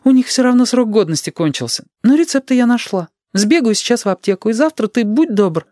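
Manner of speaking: high-pitched mother's voice; high, busy mum; hurried mother. A narrator's reading. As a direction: voice a mother chatting on her way out, pitched well up.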